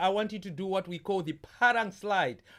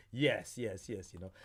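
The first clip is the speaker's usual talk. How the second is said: much lower, softer trailing yes yes; low fading mumble; 10 semitones lower, 6 dB quieter than usual. Real, not acted. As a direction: let it sink into a murmur.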